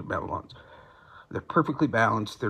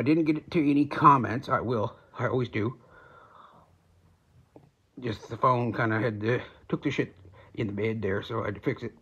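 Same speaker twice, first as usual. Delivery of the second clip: speaking through hand. Real, not acted. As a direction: hand near mouth. Seen 1: cigarette held in fingers against lips, not clearly between them.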